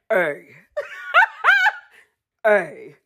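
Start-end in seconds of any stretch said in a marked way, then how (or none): 0.8-1.8 high, loud squeal of laughter; real helpless giggle. Real, not acted. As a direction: shriek with laughter. Laughing fit.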